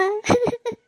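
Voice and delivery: high sob; acted tearful sobs; sobbing. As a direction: cry to your mother. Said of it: "ಯಾಕ್ ಅಳ್ತಾ ಇದ್ಯಾ?".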